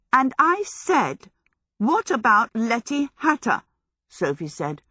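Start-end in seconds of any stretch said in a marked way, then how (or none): 0.1-3.6 insistent repeat. A narrator's reading. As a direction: press the question, refusing to be sidetracked. Sophie insistently repeats her question.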